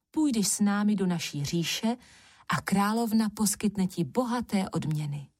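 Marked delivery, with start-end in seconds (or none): none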